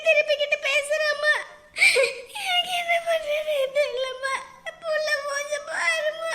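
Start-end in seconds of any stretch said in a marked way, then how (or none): 2.4-6.3 thin, tearful keening; helpless crying. Acted. Mother at a loss over her child's face, crying. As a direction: sob it out helplessly. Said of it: "அழாதீங்க".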